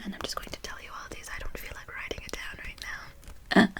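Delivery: soft, unvoiced ASMR whisper; whispered aside. Drops into a whisper.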